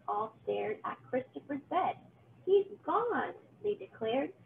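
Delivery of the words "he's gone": high, loud announcement; excited pumpkins. Acted. Pumpkins finding the empty bed, excited. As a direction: call out the news.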